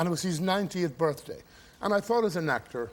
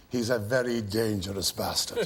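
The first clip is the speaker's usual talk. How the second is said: imitating O'Toole; 6 semitones lower than usual. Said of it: "O'Toole said".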